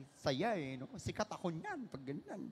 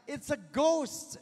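slow, high, louder frightened cry of "It's a ghost"; terrified cry; 9 semitones higher, 8 dB louder, slower than usual. A narrator's reading. Preacher reading scripture to the congregation, frightened cry. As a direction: voice the disciples' fright. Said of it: "and cried out in fear".

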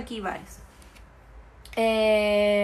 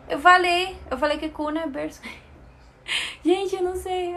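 laughing exasperation; 6 semitones higher, faster than usual. Real, not acted. Laughs at repeating herself.

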